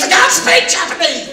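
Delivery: mock wife retort. Husband imitates wife's dumb reply, high and laughing. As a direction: blurt it like a naive wife.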